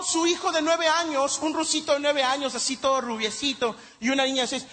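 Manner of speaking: ordinary talk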